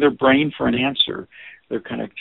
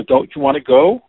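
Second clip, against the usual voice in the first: a louder pressing "Don't you want to go?"; pushy question; 9 dB louder than usual. Shown as a demonstration. Teacher voices the intrusive question, louder. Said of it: "do you hear the difference".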